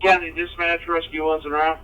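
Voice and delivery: over a two-way radio; tinny radio transmission; police radio. Real, not acted. Radio call to dispatch.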